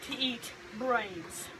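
slow, low spooky legend voice; spooky storyteller. Pretend. Telling a scary legend to a friend, slow and low. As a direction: draw the words out to scare.

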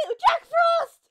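sudden high shout of the name; yelping a name in fright; startled yell. Real, not acted. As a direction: blurt the name out in shock.

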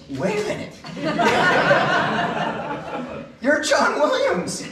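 high, loud laughing through the words; laughing disbelief. Retold or imitated. Telling how [name] praised the choir, laughing in disbelief. Hero says he admires them.

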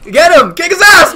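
yelling at the top of the voice; excited shout. Real, not acted. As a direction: cheer the kill on, full volume. Teamfight, cheering on a kill.

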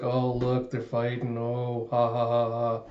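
unimpressed mock "ha ha"; sarcastic boredom. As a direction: mimic amusement with no enthusiasm. Bored mimicry of stale gag.

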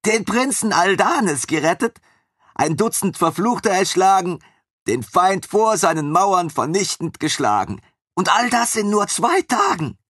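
high, gushing voice of the eager servant; devoted young servant. A narrator's reading. Armin gushes to his former master about his happy lioness, high and eager.

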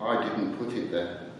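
quiet, solemn admission; sombre. Acted. The Lord answering the angel about the tear, sombre and soft. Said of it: "The Lord looked sombre and said".

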